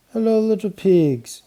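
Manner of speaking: put-on high, loud child's shout; bright shouted greeting. A narrator's reading. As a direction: call out gaily to the piglets. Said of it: "she shouted".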